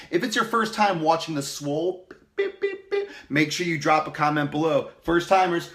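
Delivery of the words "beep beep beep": high beep beep beep; mock beeping. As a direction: beep like an alarm. Beeping for first-timers.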